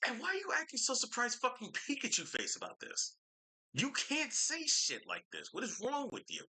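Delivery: high-pitched exasperated outburst; incredulous scolding. Real, not acted. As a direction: demand answers in a high, appalled voice. Scolding the person in the video.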